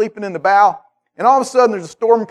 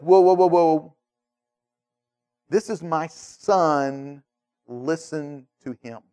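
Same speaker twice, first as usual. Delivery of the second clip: slow, dropping softer and lower to a hush; solemn divine voice; 3 semitones lower, 4 dB quieter, slower than usual. Retold or imitated. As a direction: speak with quiet authority. God correcting the disciples.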